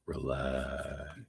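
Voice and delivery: low, soft, drawn-out calm; hushed, calming murmur; soothing. Real, not acted. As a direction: ease them down gently and quietly. Soothing reader repeats relax.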